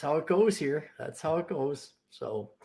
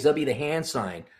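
ordinary delivery

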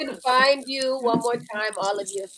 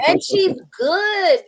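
high, slow, loud praise; excited praise; 11 semitones higher, 7 dB louder, slower than usual. Real, not acted. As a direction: draw it out with real excitement. Hyping up her friend.

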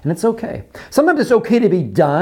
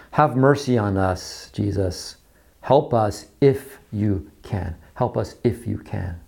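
slow, soft, low begging; quiet desperate plea; 8 semitones lower, 5 dB quieter, slower than usual. Acted. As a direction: beg for help with nothing left. Acted desperate father begging Jesus.

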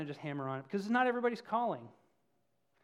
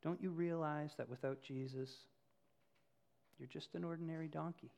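gently; 3 semitones lower, 10 dB quieter than usual. A narrator's reading. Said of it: "she said gently".

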